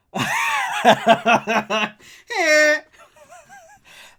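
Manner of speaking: big burst of laughter; loud laughter. Real, not acted. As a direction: howl with laughter.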